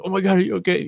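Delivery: high-pitched retold alarm; freaked out. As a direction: imitate panicked concern for a fallen friend. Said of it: "I'm just freaked out".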